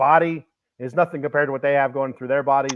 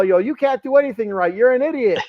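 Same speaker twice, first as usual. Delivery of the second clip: higher, louder mock scolding; imitating commentators; 8 semitones higher, 3 dB louder than usual. Commentators mocking Dom on air, higher jeering scold. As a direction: jeer at him like the booth does. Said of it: "they call".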